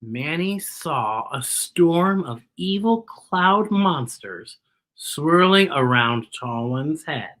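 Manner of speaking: put-on slow, dramatic read-aloud for kids; slow storybook narration; story narration. A narrator's reading. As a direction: tell it like a storybook adventure. Reads picture book aloud.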